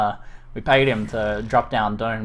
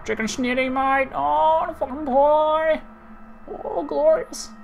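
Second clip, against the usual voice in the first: very high, hammed-up Australian accent; slower than usual. Shown as a demonstration. Streamer raving about pub food, high-pitched Australian accent. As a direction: squeal it in a cartoonish Australian accent. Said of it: "I can do an Australian accent".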